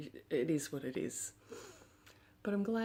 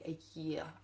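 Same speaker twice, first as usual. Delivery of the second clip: ordinary delivery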